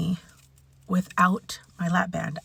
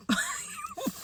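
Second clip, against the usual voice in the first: high squeaky laugh; 11 dB quieter than usual. Real, not acted. Laughing at her own sudden singing, high giggle.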